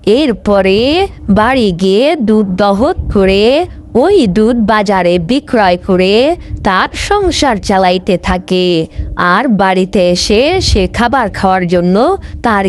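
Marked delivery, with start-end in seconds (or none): none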